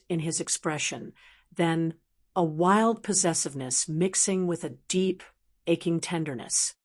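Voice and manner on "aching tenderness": ordinary delivery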